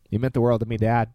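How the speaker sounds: ordinary talk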